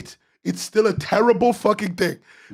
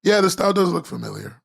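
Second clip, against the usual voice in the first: ordinary delivery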